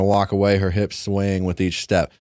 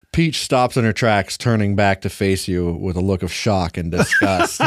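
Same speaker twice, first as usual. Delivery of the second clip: ordinary delivery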